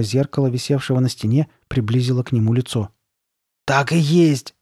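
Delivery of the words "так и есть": mild, slightly raised exclamation; crying out. A narrator's reading. Girl at the mirror, crying out. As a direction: exclaim it with a touch of shock. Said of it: "вскрикнула она".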